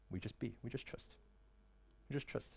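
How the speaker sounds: a calm, quiet murmur of trust; hushed trust. Real, not acted. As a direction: sink to a soft, almost whispered hush. Soft reassurance to the audience.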